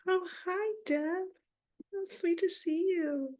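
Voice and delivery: sweet cooing greeting. Real, not acted. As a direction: coo a delighted hello to a friend.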